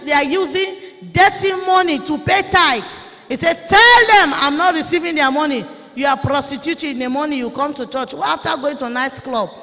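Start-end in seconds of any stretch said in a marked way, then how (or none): 0.0-5.6 loud, high preaching shout; voicing God's rebuke. Preacher voicing God's rebuke to tithe-payers, shouting. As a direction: thunder the Lord's words at the congregation.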